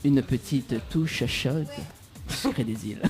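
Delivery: low, slow sultry murmur; sensual voice. Shown as a demonstration. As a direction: purr it softly and slowly. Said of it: "avec ma voix sensuelle".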